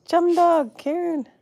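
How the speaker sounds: high, loud happy hello to friends arriving; excited greeting. Real, not acted. Host greeting friends joining the live stream, high and loud. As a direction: call out their names, thrilled to see them.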